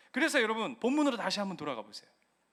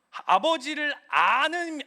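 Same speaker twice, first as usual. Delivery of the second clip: 아는 stretched slowly, raised and louder; stress on 아는; 5 semitones higher, 6 dB louder, much slower than usual. Acted. Pastor pressing the congregation, slow emphatic 아는. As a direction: draw out 아버지를 아는 with a long falling 아는. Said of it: "preacher stretches key phrase".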